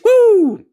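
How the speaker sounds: high, loud "woo" whoop; celebratory whoop. Real, not acted. Streamer thanking a gifter, loud high whoop. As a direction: let out a piercing cheer. Cheering a viewer's gift.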